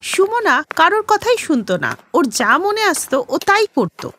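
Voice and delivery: plain reading voice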